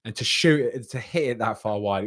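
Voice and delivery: ordinary delivery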